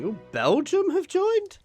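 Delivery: high-pitched surprised question; startled, rising ooh and question. Real, not acted. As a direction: shoot up high in real surprise at the news. Reacts to Belgium joining the war.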